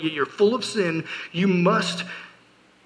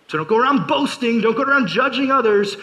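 louder, higher preaching push; forceful warning; 5 semitones higher, 4 dB louder than usual. Real, not acted. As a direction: drive it home to the crowd.

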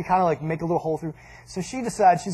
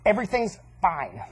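ominous mom tone; 4 dB quieter than usual. Retold or imitated. Angry mom answering dad, ominously restrained. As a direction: insist on it while meaning the opposite. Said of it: "when Mom says it like that".

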